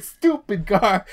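high, loud, slow New York thug jeer; Boston-New York thug accent. Retold or imitated. Retelling yells at team members, loud Boston-New York thug accent. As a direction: yell it slowly in a mock Boston accent. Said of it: "like, thug accent".

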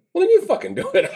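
high, laughing mock snap at the chief; laughing retort. Cop laughing as he tells the fire chief to do it. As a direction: toss it back through a laugh. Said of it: "I'm going like".

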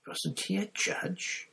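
a lightly voiced young foreign student, slow and high; Krum, puzzled. A narrator's reading. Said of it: "said Crumb".

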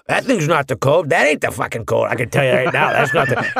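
put-on thick Boston accent, gruff and blunt. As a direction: talk like a Boston contractor. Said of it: "a thick Boston accent".

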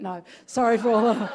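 apologizing through a laugh; laughing apology. Real, not acted. Preacher apologizing for the kiwi joke, laughing. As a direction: laugh while saying sorry.

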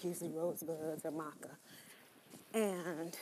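ordinary talk, nothing marked

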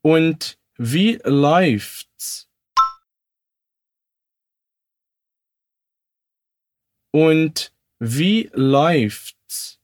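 very slow, careful question; very slow model line; very slow demo. Shown as a demonstration. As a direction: drag it out for learners to echo. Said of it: "Repete comigo".

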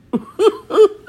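short high burst of laughter. Real, not acted. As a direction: laugh high before carrying on. Host laughing before the reveal.

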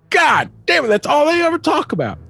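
high-pitched, hammed-up frustration; mock exasperated outburst. Kid sick of worm talk, mock exasperated outburst. Retold kid fed up with birds.

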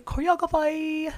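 "Choreography" sung out high and clearly; sing-song correction. Shown as a demonstration. Host correcting herself with a high sing-song "Choreography". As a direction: lilt "Choreography" up high, fixing the word. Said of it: "time for the choreography".

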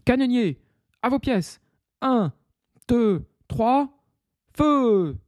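high countdown building to fire; shouted order. Acted. Pirate chief ordering the cannon, high shouted countdown. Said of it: "s'écrie".

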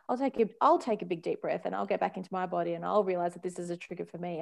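usual speaking voice

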